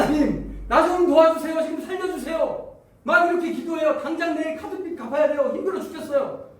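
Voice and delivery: high, urgent acted plea; desperate pleading. Debtor praying to God for help, desperate pleading. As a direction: beg for rescue, pitched right up.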